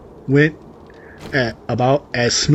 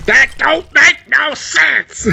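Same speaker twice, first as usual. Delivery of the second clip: high, slow, incredulous exclamation; exasperated outburst; 5 dB louder, slower than usual. Real, not acted. Commenter baffled by the story, high and slow. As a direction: drag it out in total disbelief.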